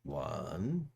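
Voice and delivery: slow, soft hypnotist counting down. Acted. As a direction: lull him gently out of a trance, slowly. Hypnotist counting to wake Brad.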